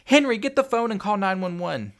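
high, loud emergency command; desperate mother shouting orders; frantic yell. A narrator's reading. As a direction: yell in panic for help. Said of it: "Tanya yelled frantically".

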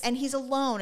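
normal talking voice

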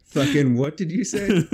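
loud, incredulous; mock outrage. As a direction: act baffled and loud. Mocking the Minnesota "bag.

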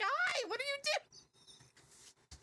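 put-on very high, slow sing-song drunk hello; drunk greeting. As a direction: greet strangers like a cheery drunk. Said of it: "I was just like".

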